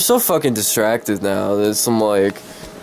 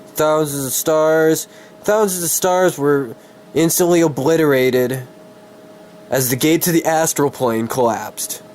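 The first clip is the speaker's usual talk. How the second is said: higher, grand storyteller read, restarting the passage; dramatic story narration; 4 semitones higher than usual. Acted. Streamer restarts his story passage for viewers, dramatic narration. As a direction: pick the epic read back up. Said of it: "trying to be all fucking dramatic".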